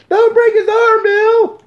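high, pleading mother's shout; a worried mother calling from ringside; frantic mom. Retold or imitated. Mother begging her son not to break the arm. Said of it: "Mama Kenway's rescue".

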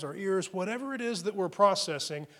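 usual speaking voice

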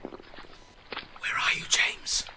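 hushed unvoiced whisper. Deserter whispers to his friend while hiding in fog, whispered. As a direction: whisper so no one hears.

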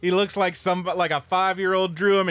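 ordinary talk, nothing marked

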